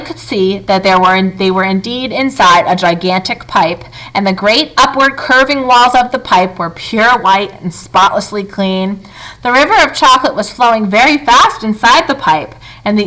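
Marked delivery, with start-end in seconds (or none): none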